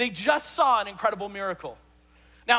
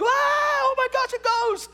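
high shrieking panic, much higher and louder; terrified scream; 7 dB louder, slower than usual. Retold or imitated. Preacher playing disciples screaming at a ghost, high panic. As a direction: shriek like you've seen a ghost. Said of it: "And they're like".

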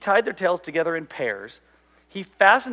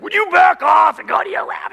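much higher, loud snapping yell; imitated angry shout; 13 semitones higher, 9 dB louder than usual. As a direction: bark it at your son. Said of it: "the demons inside me came out".

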